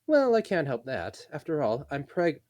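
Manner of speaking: slow, high breezy elf; lilting pregnant elf; high elf woman voice. Acted. Elena's high voice.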